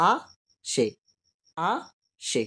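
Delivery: আ and সে spoken as separate syllables with pauses between; slow syllable-by-syllable demo of আসে; syllables split apart. Shown as a demonstration. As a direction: break আসে into আ, সে, each on its own. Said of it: "এর মধ্যে কয়টি দল রয়েছে".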